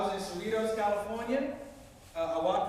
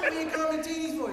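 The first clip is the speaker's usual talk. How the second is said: higher-pitched mock indignation; indignant protest; 9 semitones higher, 4 dB louder than usual. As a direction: protest the nickname in an offended pitch. Acts out protest at being teased.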